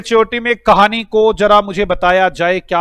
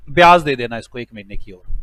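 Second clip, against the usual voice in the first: much lower, settled judge's verdict; judge's ruling; 9 semitones lower than usual. Retold or imitated. Judge tells the debtor to pay interest, much lower voice. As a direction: drop the voice low like a judge deciding.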